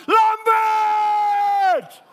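very high, loud yell of the name; angry shout. Retold or imitated. RSM yelling at Lambert for turning wrong, furious shout. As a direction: bellow the name across the parade ground. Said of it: "you just hear this guy shout".

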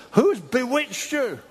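high, indignant mock shout; acted furious demand. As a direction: imitate an apostle crying out in outrage. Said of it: "He's furious".

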